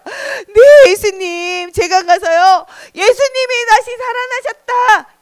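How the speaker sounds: high-pitched, loud, eager reply; eager acceptance. Acted. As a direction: answer keenly and proclaim it. Mary eagerly accepts the mission.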